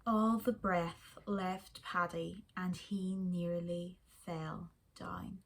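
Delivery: ordinary delivery, nothing marked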